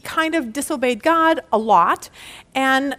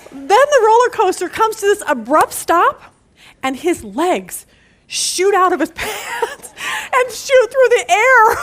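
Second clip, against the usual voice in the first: laughing; 7 semitones higher, 4 dB louder, slower than usual. Real, not acted. Cracking up retelling the ride.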